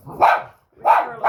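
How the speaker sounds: high, loud call of the dog's name. Real, not acted. Calling the dog sharply.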